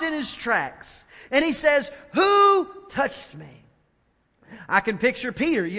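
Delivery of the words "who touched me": Jesus calling out. Acted. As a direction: stop and demand who touched you. Jesus stops, asks crowd.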